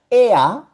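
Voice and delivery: "E A" high and loud, E gliding to A; two-vowel sound demo. Shown as a demonstration. Teacher contrasting the two ER sounds, emphatic "E A". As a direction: punch out "E A" with both vowels. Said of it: "la E con la R suena".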